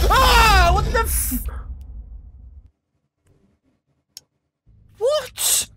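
high panicked what; terrified scream. Real, not acted. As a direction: shriek at the scare. Another jump scare.

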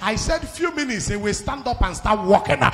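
loud, driving sermon at full volume; full-force preaching. Real, not acted. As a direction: thunder it out to the congregation.

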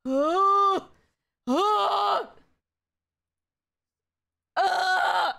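high, loud swooning "oh"; excited squeal. Real, not acted. Fan swooning over a friend's debut, squealing. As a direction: let out short high squeals of delight.

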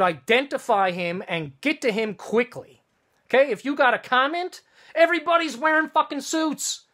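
high-pitched, exasperated tirade; worked-up, indignant venting; real angry rant. Real, not acted. Angry rant about the accuser.

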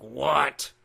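gruff fed-up dad voice; weird voice. Retold or imitated. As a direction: grumble it like a worn-out father. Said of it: "That was a weird voice".

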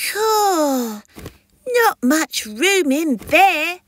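high-pitched newborn creature voice; cheeky hatchling. Acted. Newborn baby creature's first words.

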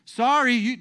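high, loud fussy protest; hammed-up indignant objection; objecting religious leaders. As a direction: forbid it as a scandalized official. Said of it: "the ones who are like".

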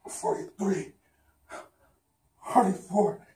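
strained breathy counting of reps; doing push ups. Real, not acted. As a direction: count each rep under effort, voice fading.

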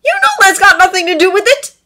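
high, loud, stung protest. A narrator's reading. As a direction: call it out, hurt and defensive. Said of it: "Draco called, stung".

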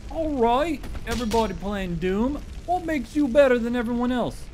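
high, challenging mock viewer voice; imitated chat viewer. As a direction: taunt like a cocky newcomer in chat. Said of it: "somebody coming into the stream and being like".

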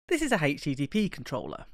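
ordinary delivery

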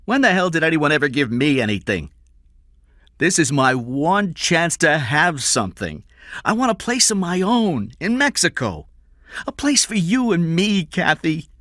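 high, loud, impassioned plea; tearful outburst. A narrator's reading. Eddie pleads with Kathy for his one chance, tearful. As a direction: build from bitter complaint to high pleading. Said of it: "tears in his eyes as he spoke".

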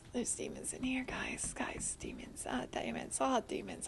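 very soft, half-whispered alert; hushed mock warning. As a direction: whisper to the viewers that danger is near. Hushed warning to viewers about demons.

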